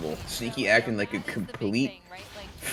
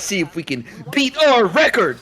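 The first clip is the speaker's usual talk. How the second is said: higher and louder, hyped; excited; 10 semitones higher, 8 dB louder than usual. Real, not acted. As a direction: pump up the run. Hyped about a speedrun attempt.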